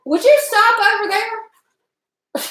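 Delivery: high, drawn-out scolding; yelling at dog. Real, not acted. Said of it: "I'm yelling at the dog".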